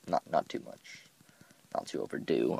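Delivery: usual speaking voice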